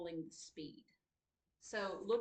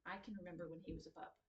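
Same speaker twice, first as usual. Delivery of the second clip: read aloud quickly; 4 semitones lower, 9 dB quieter, faster than usual. Shown as a demonstration. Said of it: "It's faster to say the second one".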